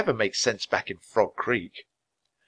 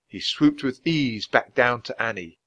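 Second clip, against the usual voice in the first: plain reading voice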